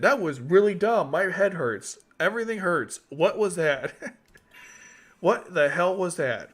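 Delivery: ordinary talk, nothing marked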